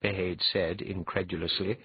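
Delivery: plain reading voice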